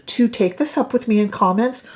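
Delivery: ordinary delivery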